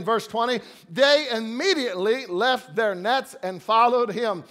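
usual speaking voice

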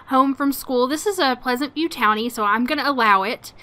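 usual speaking voice

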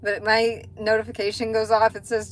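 normal talking voice

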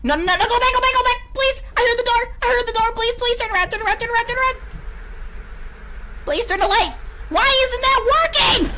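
real fear, frantic and shrill-pitched; frantic high pleas; panicked screaming. Real, not acted. Heard door, panicking.

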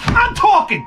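high-pitched cry of protest; irritated outburst. Real, not acted. Host to someone interrupting his story, irritated outburst.

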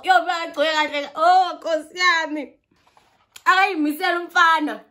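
breathless delight about the guy; excited raving. Real, not acted. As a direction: gush in disbelief.

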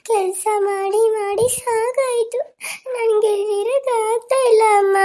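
high, slow, tearful begging of her mother; a girl sobbing not to be left; crying child. Acted. Child begs dead mother, crying.

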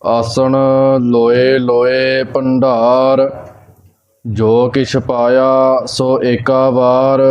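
measured Gurbani recitation on a level tone; even, unhurried devotional reading; scripture recitation. Real, not acted. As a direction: recite line by line with drawn-out endings.

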